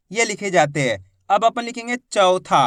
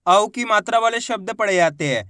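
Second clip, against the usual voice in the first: ordinary talk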